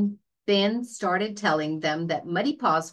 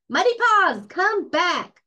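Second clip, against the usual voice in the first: high, loud call after a runaway dog; 11 semitones higher, 5 dB louder than usual. A narrator's reading. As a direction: shout it across the farmyard. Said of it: "called Ben".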